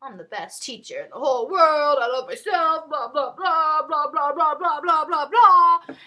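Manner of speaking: mock-grand bragging, building into loud blahs; boastful teacher. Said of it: "one day she was like".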